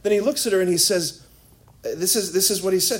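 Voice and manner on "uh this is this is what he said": usual speaking voice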